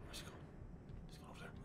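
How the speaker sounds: hushed, hiding from monster; whispering. Real, not acted. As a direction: breathe it so he won't hear.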